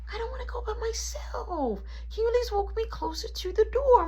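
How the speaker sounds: put-on very high, whiny child voice; squeaky mock child plea; whining kid. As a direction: imitate a kid begging for company. Kid pleads not to go alone.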